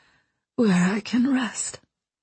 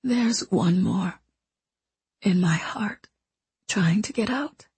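weak dying whisper. Acted. Dying mentor confesses her past to the boy, weak whisper. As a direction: let each phrase cost her breath.

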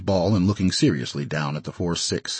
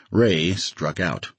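plain reading voice

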